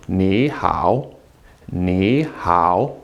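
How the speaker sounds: "Ni" rising into low dipping "hao"; second-tone Ni. Shown as a demonstration. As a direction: raise "Ni", then dip on "hao". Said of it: "making the first one a second tone".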